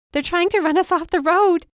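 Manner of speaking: high, loud terrified cry; panicked. Acted. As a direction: scream it out in panic. Panicked call during car chase.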